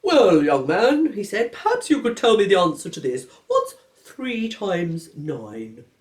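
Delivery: slow, stern headmaster voice. Acted. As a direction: lay down the rule, slowly.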